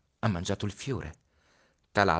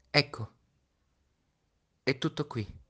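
put-on higher child's voice, fragile; a boy fretting over his flower; Little Prince, tender and worried; 8 semitones higher than usual. (Piccolo Principe, voce acuta e tenera)

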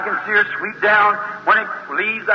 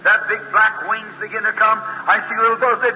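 ordinary delivery

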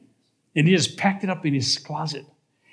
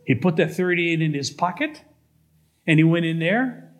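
usual speaking voice

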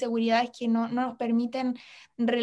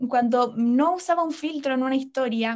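normal talking voice

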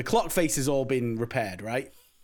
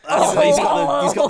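louder, higher, laughing; 10 semitones higher, 9 dB louder than usual. Real, not acted. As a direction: laugh through the words. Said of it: "Is that the sound you make when you shrug?".

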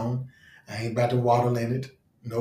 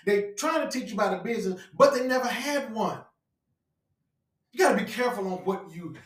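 higher, deliberate sermon delivery; emphatic preaching; 6 semitones higher than usual. Real, not acted. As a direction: preach it as an urgent warning.

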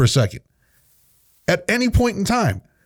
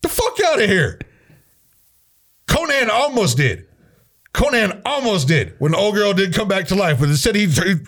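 normal talking voice

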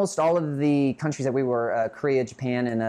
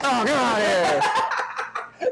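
freaking-out chef; 8 semitones higher, 4 dB louder than usual. Retold or imitated. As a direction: rant like a furious celebrity chef. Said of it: "like one of those, uh, cooks who, like, freaks out".

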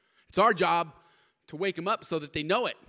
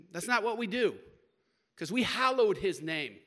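higher, louder preacher rebuke; preaching at full force; 3 semitones higher, 7 dB louder than usual. Acted. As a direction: rise up and rebuke it from the pulpit.

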